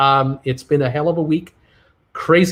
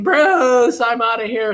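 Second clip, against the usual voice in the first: mock huff; 6 semitones higher, slower than usual. Mock walk-out repeated.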